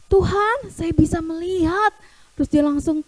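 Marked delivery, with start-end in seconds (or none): 0.1-1.9 higher, louder delight; overjoyed exclamation. Acted. Healed blind man tells the Lord, overjoyed. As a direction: exclaim with amazed joy. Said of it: "Dia merasa sangat senang".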